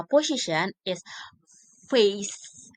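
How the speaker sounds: usual speaking voice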